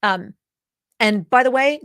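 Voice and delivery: normal talking voice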